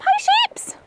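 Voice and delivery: very high sing-out greeting; greeting animals. Real, not acted. Owner greets the sheep, very high greeting voice. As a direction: squeal hello to the animals.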